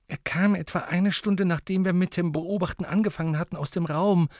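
put-on high-pitched girl's voice; Ginny.

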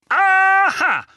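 high, triumphant roar; röhrend. A narrator's reading. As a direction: bellow it in triumph. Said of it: "rührte Onkel Vernon". (triumphierendes, lautes Röhren)

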